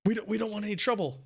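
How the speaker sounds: pleading. Acted. Game master as frightened captives begging adventurers to leave, pleading. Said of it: "they start, uh, pleading".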